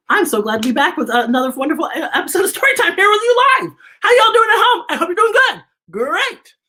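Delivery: laughing greeting. Real, not acted. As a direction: whoop hello to the viewers, giggling. Greeting live audience.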